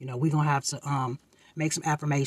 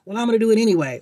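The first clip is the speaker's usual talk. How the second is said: defiant comeback; 5 semitones higher, 7 dB louder, much faster than usual. Retold or imitated. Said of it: "you might come back with".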